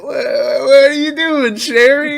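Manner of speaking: high, drawn-out confused voice; dude voice. Retold or imitated. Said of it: "the dude's like".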